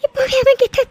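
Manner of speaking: tearful plea, slowing into sobs; crying. Acted. Girl crying to her friend about her missing love, tearful. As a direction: voice breaking with tears. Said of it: "இன்னும் எவ்வளவு நேரம் தாண்டி அழுதுட்டே இருக்க போற?".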